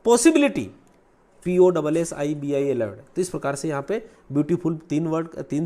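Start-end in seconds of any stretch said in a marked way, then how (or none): 0.1-0.7 "possibility" high and loud, every syllable distinct; syllable demonstration. Shown as a demonstration. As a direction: punch out the five beats of "possibility". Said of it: "possibility में पाँच है".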